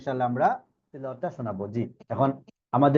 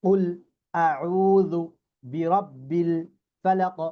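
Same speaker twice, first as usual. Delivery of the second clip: each word set apart by pauses; slow piece-by-piece Quran recitation; much slower than usual. Shown as a demonstration. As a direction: recite broken into single words for the class. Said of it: "শব্দগুলো ভেঙে ভেঙে বলবো".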